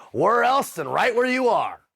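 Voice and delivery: high, slow, drawn-out rhetorical question; emphatic quotation. Retold or imitated. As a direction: stretch it out high, like proclaiming wisdom. Quoting Seneca emphatically.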